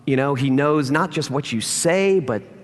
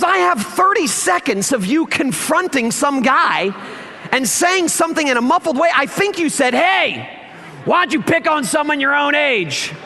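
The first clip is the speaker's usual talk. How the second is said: high, lightly voiced friend laughing as he retells; a hint of amused Zach; Zach on the phone; 10 semitones higher than usual. Zach calls next morning to tease the pastor, high and amused. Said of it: "He goes".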